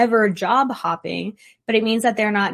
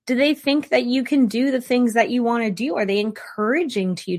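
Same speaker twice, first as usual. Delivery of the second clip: ordinary delivery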